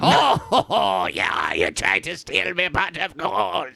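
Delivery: Irish leprechaun voice, laughing; cheeky leprechaun guarding his gold. Pretend. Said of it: "I picture him as a little leprechaun".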